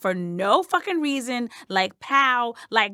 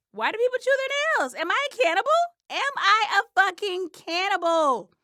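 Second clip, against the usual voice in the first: high panicked; 9 semitones higher than usual. Acted. Panicked rising questions.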